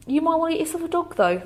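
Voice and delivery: imitated doctor, high-pitched and quick; doctor's offhand suggestion.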